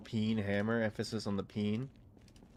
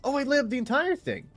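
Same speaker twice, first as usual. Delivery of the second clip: excited; 4 dB louder than usual. Real, not acted. Room lights up.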